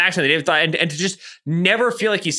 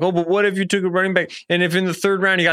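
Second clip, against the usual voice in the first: quick, higher mock nerd voice; 5 semitones higher, faster than usual. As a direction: rattle it off like a stats nerd. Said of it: "Do that voice more".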